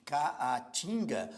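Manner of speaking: "caatinga" very slow, as ca, a, tinga; syllable by syllable. Shown as a demonstration. Teacher showing double letters fall apart, "caatinga" slowly broken as ca, a, tinga. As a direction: separate the two As in "caatinga". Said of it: "ficam em sílabas diferentes".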